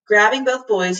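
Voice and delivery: ordinary delivery, nothing marked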